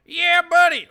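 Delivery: loud, high hammed-up "Yeah, buddy"; mock yell. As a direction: bellow it like the rally guy. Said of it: "Listen to this guy just go".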